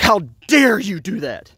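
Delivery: high, slow, indignant mock fury; a high, drawn-out imitated "how dare you"; retold angry outburst. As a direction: throw her outrage back at me, slowly. Girlfriend's furious outburst retold.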